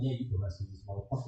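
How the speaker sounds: mock careless boss; offhand managers. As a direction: toss it off like you don't care. Managers quoted, dismissive.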